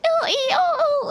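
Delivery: tiny squeaky hamster noises, very high. Retold or imitated. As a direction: squeak like a hamster in her ear. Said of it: "did the hamster voice".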